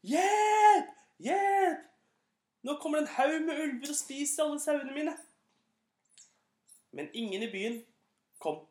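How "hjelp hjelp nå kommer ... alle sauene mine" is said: high, loud cry for help in real fear; terrified shout. Acted. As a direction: scream with all the lungs' force. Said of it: "med alle sine lungers fulle kraft". (redd rop for full hals)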